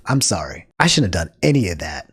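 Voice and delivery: lightly voiced contrite Linus; Linus's ideal apology. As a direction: voice the apology he should have given, low and plain. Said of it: "Imagine a seven second".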